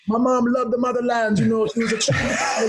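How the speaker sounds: high, hammed-up Jamaican accent. Wrestler making up answers for the interviewer, in a Jamaican accent. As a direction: bluff your way through in Jamaican. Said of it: "why are you playing this Jamaican character".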